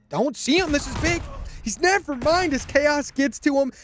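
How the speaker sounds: excited. Real, not acted. Caster calls a big play.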